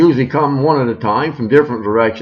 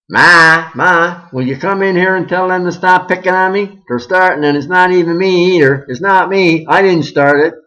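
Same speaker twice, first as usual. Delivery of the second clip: put-on whining child; 6 semitones higher, 6 dB louder than usual. Kid whining to mother.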